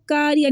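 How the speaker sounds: कारिया with light k, "kaariya"; front, thin k in कारिया; thin wrong k. Shown as a demonstration. Teacher showing the error, thin "kaariya". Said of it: "नहीं पढ़ना".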